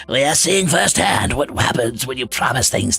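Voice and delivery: low, drawn-out lordly advisor voice. Acted. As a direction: counsel a liege in slow, weighty tones. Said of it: "that's how I've decided V V sounds".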